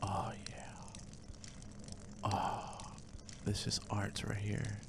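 soft unvoiced whisper. Real, not acted. Player relishing the moment, whispering softly. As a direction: savour it under the breath. Whispering while peeing in game.

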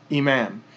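"imam" stopping on the m, ending dropped; pausal imam. Shown as a demonstration. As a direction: end "imam" cleanly on the m. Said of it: "we would just say this is".

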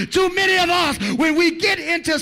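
loud, driving sermon shout; full-force preaching. Acted. Preacher shouting to the church about whining, full force. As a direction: hammer it home to the congregation.

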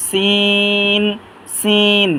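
সিন with a long, level "ee"; drawn three counts. Shown as a demonstration. Teacher names the letter for learners, drawn long. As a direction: stretch the vowel of সিন evenly. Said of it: "তিন আলিফ টানবো".

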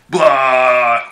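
loud mock vomiting noise, a wet blah; imitated retching. Telling friends how he threw up on her, loud mock retch. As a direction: act out the retch with a burst of sound. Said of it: "Projectile vomit just all over her head".

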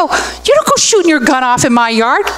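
indignant mock telling-off; retold scolding. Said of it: "I go".

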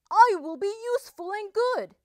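high, loud, quick declaration; eager resolve. A narrator's reading. Wolf making up his mind to be useful, eager and resolved. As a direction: announce the resolution with eager conviction.

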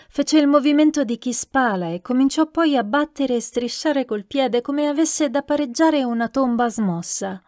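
usual speaking voice

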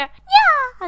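high, loud yelped "Yeah"; censor noise. Shown as a demonstration. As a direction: yelp it like a bleep. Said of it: "I'll just make a noise, go".

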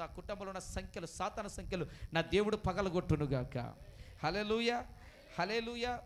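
loud, climactic deliverance prayer; fervent preaching. Acted. Pastor proclaims victory over bonds to the congregation, loud. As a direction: declare it full force over the people.

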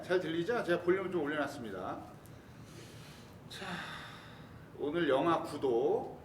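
ordinary delivery

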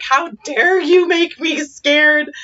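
high, slow, indignant child imitation; drawn-out mock indignation; angry scared. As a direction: protest like an outraged, frightened kid. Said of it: "angry scared".

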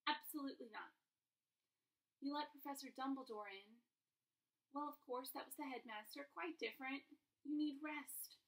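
strict nurse. Acted. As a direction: put your foot down like a firm nurse. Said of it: "very strict".

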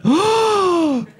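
mock collective gasp, high drawn-out "oh"; gasp, then scold in mock shock; imitated shocked audience. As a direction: imitate a crowd's scandalised reaction. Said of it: "five hundred people just went".

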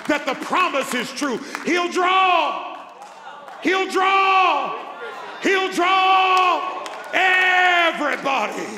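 shouted, climbing high, each phrase hammered out; preaching at full force. Acted. Preacher closing the sermon to West Ohio, roaring climax. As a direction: build it to a roaring climax, calling the whole room.

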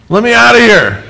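high, loud pretend panic; mock panicked shout. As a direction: yell to be let out, hammed-up. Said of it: "I'm like".

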